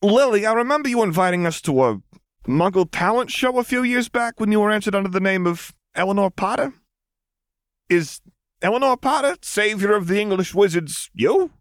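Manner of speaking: high, probing secretary voice; Frank curious. Acted. Secretary quizzing his boss Lily, high and sly.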